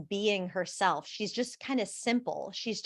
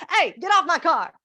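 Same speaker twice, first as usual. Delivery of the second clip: higher, louder shout at someone sliding on his car; mock yell from off camera; 8 semitones higher, 9 dB louder than usual. Bystander off camera yelling at Tyra, a mock angry shout. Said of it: "a guy goes".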